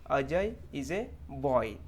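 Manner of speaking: put-on slow word-by-word, long oy in "boy"; clear "oy" reading. Shown as a demonstration. Teacher reading an example sentence, slow with clear oy in "boy". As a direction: read it very slowly, stretching "boy". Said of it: "आप अगर उसको पढ़ेंगे".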